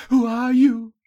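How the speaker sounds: high, loud anxious question; captive's high-pitched demand to know; alarmed question. A narrator's reading. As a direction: ask it high and urgently. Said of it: "she whispered".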